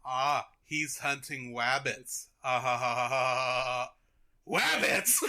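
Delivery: slow drawn-out cartoon voice with dopey laugh; hammed-up mock hunter talk; mocking cartoon dog. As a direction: say "wabbits" and chuckle in rhythm. Dog mimics the hunter and his laugh.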